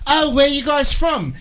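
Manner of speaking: lightly voiced friendly British lady; British woman. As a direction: play the British woman asking where they're from. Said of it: "she was like".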